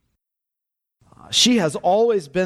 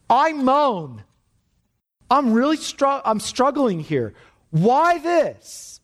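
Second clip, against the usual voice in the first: high, pleading psalm lament; a psalmist's raised, aching plea; anguished lament; 5 semitones higher than usual. Acted. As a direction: cry it out to God in anguish. Reading the anguished psalm aloud.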